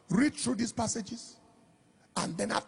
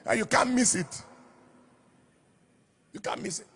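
normal talking voice

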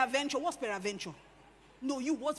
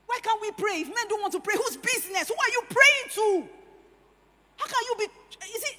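higher and louder with each question until a shout; rising preaching shout; 9 semitones higher, 7 dB louder than usual. Real, not acted. Preacher confronting women who complain, rising to a shout. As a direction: build the fire up to the last question.

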